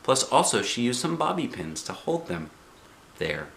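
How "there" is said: soft, low, settled; satisfied mother. A narrator's reading. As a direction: finish the job gently. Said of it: "she said".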